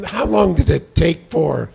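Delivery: ordinary talk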